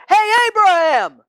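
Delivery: high, loud call across a distance; hollering. A narrator's reading. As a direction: holler out to get someone's attention. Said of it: "And so he hollered".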